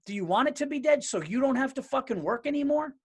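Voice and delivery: high, loud, incredulous challenge; worked-up, disbelieving demand; indignant rant. Real, not acted. Indignant rant at comedy-is-dead question.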